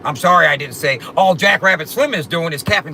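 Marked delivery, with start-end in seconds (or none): 1.2-2.9 louder, crammed-in version of the bar; too many syllables. Shown as a demonstration. Showing chat why the long name breaks the rhyme, crammed. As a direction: cram the extra syllables in to show it won't fit. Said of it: "that's too many syllables".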